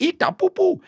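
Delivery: high-pitched mock West African accent. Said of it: "like, a West African accent".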